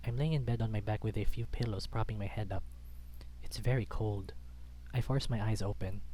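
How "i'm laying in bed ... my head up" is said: plain reading voice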